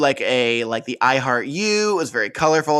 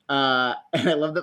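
ordinary talk, nothing marked

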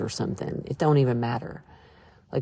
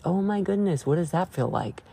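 ordinary delivery

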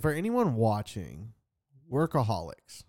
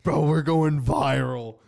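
hyped; 5 semitones higher, 10 dB louder, slower than usual. Pretend. Hyping their own show.

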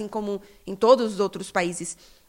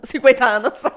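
laughing; 3 semitones higher, 9 dB louder than usual. Real, not acted. Repeats the slip while laughing.

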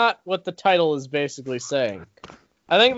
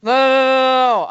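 higher, louder rapid denial; loud protest; 6 semitones higher, 5 dB louder than usual. Real, not acted. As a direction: cut him off with a loud no. Protesting accusation of bias.

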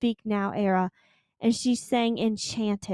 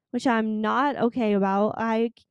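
usual speaking voice

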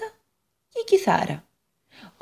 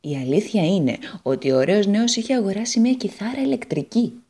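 ordinary delivery

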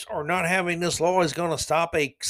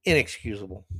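ordinary delivery

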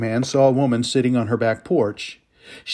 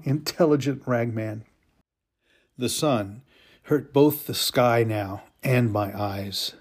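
ordinary delivery, nothing marked

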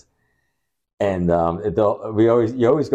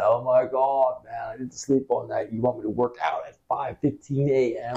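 groggy whine; 7 semitones higher than usual. Retold or imitated. Acting groggy morning self.

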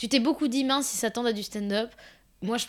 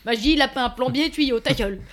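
louder, blunt barked word pairs; aggressive guy; 3 semitones higher, 7 dB louder than usual. Pretend. Mocking an aggressive guy, louder and blunt. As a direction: play a pushy, aggressive guy. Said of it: "Mec agressif".

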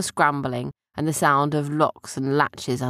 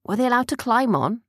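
ordinary delivery

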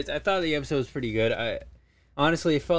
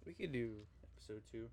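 barely audible, far softer; too quiet; 21 dB quieter than usual. Real, not acted. Answering the host, so faint she can't be heard. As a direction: mumbles it almost off mic. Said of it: "I can't hear you".

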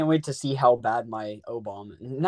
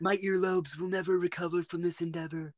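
put-on presidential impression; Donald Trump; 4 semitones higher, 4 dB quieter than usual. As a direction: declare it in a Trump-style voice. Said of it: "like, a Donald Trump voice".

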